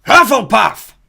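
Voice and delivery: loud, high drawn-out shout; hat shouting. A narrator's reading. The hat shouts its verdict to the hall. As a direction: announce the house with a booming cry. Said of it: "shouted the hat".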